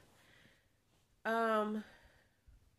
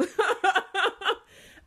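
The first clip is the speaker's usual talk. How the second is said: high loud burst of laughter; 12 semitones higher, 8 dB louder than usual. Real, not acted. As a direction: crack up loudly. Big laugh at kicks.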